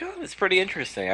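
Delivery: mildly impressed. Retold or imitated. Acts out own impressed reaction.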